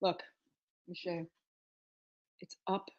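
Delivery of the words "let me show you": normal talking voice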